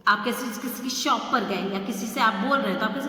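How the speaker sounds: ordinary delivery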